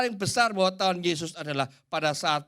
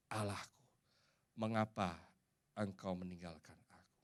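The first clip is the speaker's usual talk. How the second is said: low, very soft quoted lament; quiet forsaken plea; 8 semitones lower, 16 dB quieter than usual. Acted. Jesus asks God why he is left alone, hushed acted plea. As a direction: let the plea sink low and fade away.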